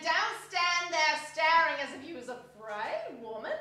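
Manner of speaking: mock their fear; scoffing charwoman.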